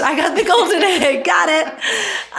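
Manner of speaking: high gleeful brag broken by a laugh; laughing golden egg boast; laughing mock boast. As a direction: giggle through the kid's triumph. Repeating the egg joke.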